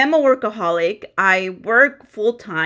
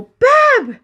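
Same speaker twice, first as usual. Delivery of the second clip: very high, fast, exaggerated whine; mock shriek at husband; 4 dB louder than usual. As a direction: squeal it at him like a wronged wife. Retold complaint to husband, waking late.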